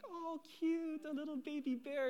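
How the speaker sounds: high-pitched coo at a cute animal; delighted cooing. Retold or imitated. Woman spotting a baby bear in the woods, high delighted coo. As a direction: imitate someone gushing over something adorable.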